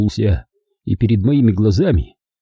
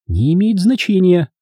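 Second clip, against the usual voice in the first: high, sharp woman's retort; 9 semitones higher, 3 dB louder than usual. A narrator's reading. Hilda dodges the question of her name, sharply. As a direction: cut him off in a high voice. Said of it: "резко бросила она".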